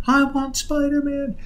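put-on very high, drawn-out toddler yell; imitated child's yell. Son yelling over his mother's pleas, high mock yell. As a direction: wail it slowly over someone talking. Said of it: "he would yell over top of her".

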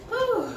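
relieved exhale. Real, not acted. After the hard set.

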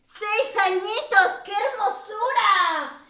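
high, loud, slow cooing delight; adoring gush. Real, not acted. Host delighted by a six-year-old caller, adoring gush.

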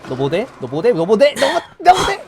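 building from plain to screaming; escalating rant. Acted. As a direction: climb into a furious yell. Repeated, escalating.

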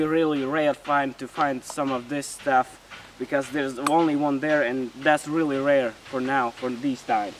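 ordinary talk

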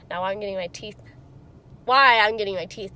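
talking around finger; finger in mouth. Real, not acted. Seen 0: Fingertip on lower teeth and lip while she talks, until 2.7.

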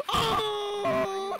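high, slow excited yell; excited shout. Real, not acted. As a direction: holler at the fish. Landing the big fish.

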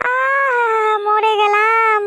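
sharp high cry of pain; high yelp of pain, then a wail. Acted. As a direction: yelp as the hook catches her head. Fishing hook caught in her head.

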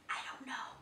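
soft unvoiced whisper. A narrator's reading. Chimpanzee hiding from Big Bro, whispering.

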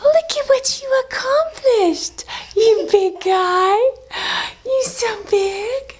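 high, slow, emotional praise; tearful pride. Real, not acted. Repeat, higher, tearful.

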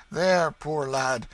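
high, loud, kindly urging; benevolent stranger, fussing. A narrator's reading. Stranger asking the boy to help carry Hugo, kindly. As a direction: give eagerly and call the boy over.

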